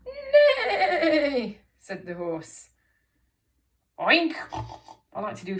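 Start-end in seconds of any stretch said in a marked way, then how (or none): 0.1-1.6 loud, high, wobbling horse whinny; big whinny. Shown as a demonstration. Teacher showing children a right big whinny. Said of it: "Let's do a right big whinny". 4.0-4.3 loud, higher oink with snorts; expressive pig oink. Shown as a demonstration. Teacher leading children in animal sounds, expressive pig oink. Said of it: "say this with loads of expression".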